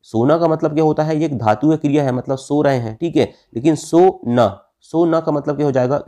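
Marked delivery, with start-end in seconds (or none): 3.8-4.6 "सो ना" as two slow words; pause inside word. Shown as a demonstration. Teacher showing juncture to students, slow split words. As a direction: leave a clear gap between सो and ना. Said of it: "बीच में विराम दूंगा".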